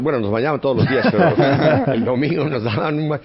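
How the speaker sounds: amused, laughing through the words; laughing while talking. Real, not acted. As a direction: chuckle through the memory of Sunday baths. Laughing while recalling Sunday baths.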